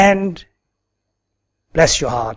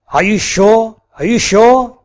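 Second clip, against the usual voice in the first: rising, higher mocking repeat; sarcastic; 6 semitones higher than usual. Retold woman mocking the man's apology, sarcastic. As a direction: needle him with doubt, sarcastic. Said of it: "punishing me with sarcasm".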